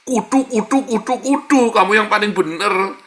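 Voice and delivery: shouting kudu, kudu like a stubborn brat; mock stubborn insistence. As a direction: lampoon the know-it-all who must be right. Mocking the stubborn self-righteous.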